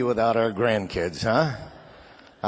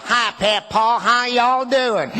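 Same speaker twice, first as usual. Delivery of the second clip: put-on higher, slow Southern kid voice; Southern accent; 8 semitones higher, 4 dB louder, much slower than usual. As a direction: put on a Kentucky drawl like a little kid. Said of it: "my beautiful Italian grandkids would walk up to me and go".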